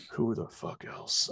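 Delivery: whispered aside to himself. Real, not acted. Host searching his memory for Boston names, whispered. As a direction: breathe it out under your breath.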